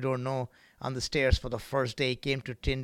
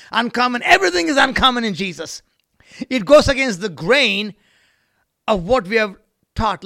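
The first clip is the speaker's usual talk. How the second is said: raised, emphatic pulpit cadence; fervent preaching; 8 semitones higher, 9 dB louder than usual. Real, not acted. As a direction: hammer each point home to the congregation. Preaches at full force.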